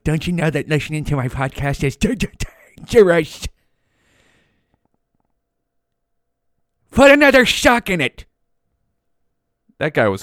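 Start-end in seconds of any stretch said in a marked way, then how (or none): 0.0-8.2 raspy manic comedy fire marshal voice, jumping higher; Fire Marshal Bill. Retold or imitated. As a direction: play the wild, warning fire inspector. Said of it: "talking like fucking Fire Marshal Bill".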